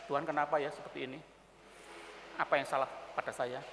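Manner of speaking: low, soft questioning of God; acted subdued bewilderment; troubled prayer. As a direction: ask God quietly what went wrong. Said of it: "Lalu kita bertanya".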